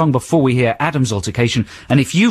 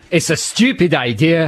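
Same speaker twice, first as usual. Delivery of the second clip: Duncan Ballantyne; 5 semitones higher, slower than usual. Acted. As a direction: dismiss it in a raised, higher Scottish voice. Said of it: "I love your impression of that guy".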